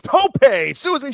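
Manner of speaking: high, drawn-out mock move call; mock announcer yell. Mocking Excalibur naming moves, high announcer yell. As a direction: imitate a commentator yelling out a move. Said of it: "he just yells out the name of the move".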